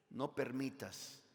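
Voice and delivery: usual speaking voice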